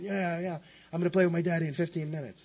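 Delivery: put-on a boy thrilled he'll play with daddy soon; excited little boy.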